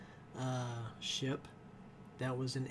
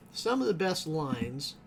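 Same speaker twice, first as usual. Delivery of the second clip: usual speaking voice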